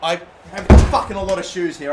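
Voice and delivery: frustrated outburst. Real, not acted. Frustrated shout mid-game.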